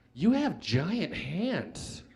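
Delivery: very slow, swooping, dreamy marvel; awestruck girl. Retold or imitated. Girl holding his hand marvels at it, awestruck. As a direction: draw each word out in soft wonder.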